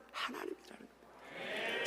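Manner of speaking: normal talking voice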